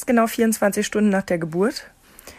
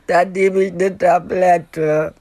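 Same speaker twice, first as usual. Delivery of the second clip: slow, loud, wailing through tears; imitating tearful pleading; 5 dB louder, much slower than usual. Crying new mother begging the nurse, slow tearful wail. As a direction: drag each word out sobbing. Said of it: "immer noch furchtbar geweint".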